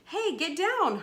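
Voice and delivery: high, loud call to a misbehaving pet; urgent scolding. Acted. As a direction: shoo the cat off sharply, voice jumping up. Boy scolds cat on the stove.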